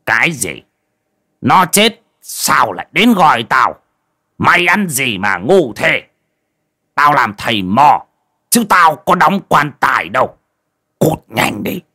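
irritated shaman berating a visitor; gruff, indignant dismissal; angry. A narrator's reading. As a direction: scold crossly and send them away. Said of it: "Giọng của lão thầy Mo chuyển sang tức giận".

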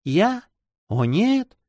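put-on high girlish protest; exclaiming in protest. A narrator's reading. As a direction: cry out a heartfelt denial. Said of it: "воскликнула она".

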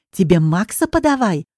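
plain reading voice

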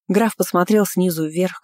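plain reading voice

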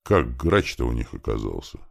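ordinary delivery

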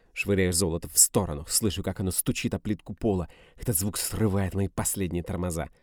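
plain reading voice

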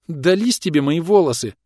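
high-pitched indignant protest; loud indignation. A narrator's reading. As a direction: protest with mild outrage, pitch jumping up. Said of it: "громко возмутилась девушка".

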